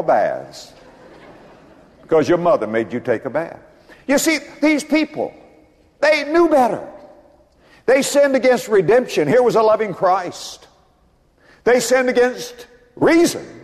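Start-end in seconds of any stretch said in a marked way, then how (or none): 4.1-13.5 high, ringing sermon climax; high, full-voiced pulpit preaching; fiery preaching. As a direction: preach it out to the rafters.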